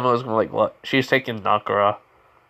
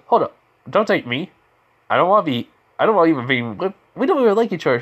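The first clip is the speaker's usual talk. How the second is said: acted protest; 6 semitones higher, 4 dB louder, faster than usual. Naruto objects to being taken.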